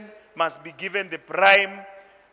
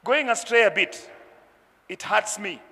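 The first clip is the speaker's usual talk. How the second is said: normal talking voice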